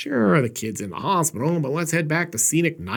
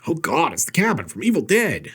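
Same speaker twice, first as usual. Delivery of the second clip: ordinary delivery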